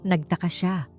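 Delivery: ordinary delivery, nothing marked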